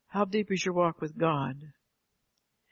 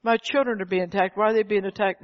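louder, higher plea to God; protesting parent; 3 semitones higher, 5 dB louder than usual. Acted. Parent asking God about attacked children, protesting plea. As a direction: demand an answer, voice raised.

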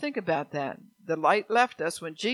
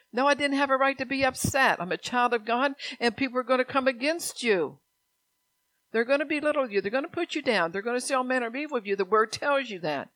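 higher, forceful preacher voice; emphatic preaching; 7 semitones higher, 6 dB louder than usual. Real, not acted. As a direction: drive the warning home, pitched up high.

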